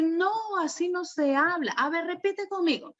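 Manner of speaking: mock stern correction, pitched high and soft; scolding teacher. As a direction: play the impatient teacher scolding a child. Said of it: "no le voy a decir".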